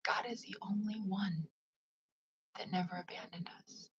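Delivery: whispered, soft and reverent; soft affirmation. Shown as a demonstration. Repeating her affirmation to herself, soft and reverent. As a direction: breathe the words like a prayer. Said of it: "Let's say it again".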